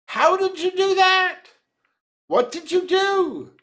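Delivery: excited men pressing for an answer; high-pitched, eager demand; breathless urging. A narrator's reading. Men urging the trapper to explain his capture, breathless. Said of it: "the men urged breathlessly".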